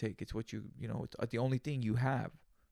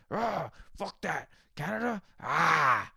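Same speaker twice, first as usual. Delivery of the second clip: slow, high, hammed-up outrage, louder at the end; mock outraged rant; 5 semitones higher, 5 dB louder, much slower than usual. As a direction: play a hothead spitting out a slow, drawn-out angry rant. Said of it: "I feel like I'm supposed to".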